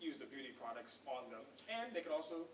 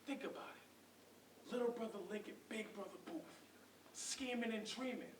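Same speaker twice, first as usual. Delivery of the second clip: persuasive hustling Booth; 4 semitones higher than usual. Acted. Booth tempting Link with money and women, persuasive. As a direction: coax them with a dreamy, building sales pitch.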